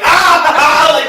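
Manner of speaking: high loud sudden ah; mock startled yelp. Friend acting out the startled driver, a loud yelp. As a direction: jump at the popping noise. Jelly packets popping by the window.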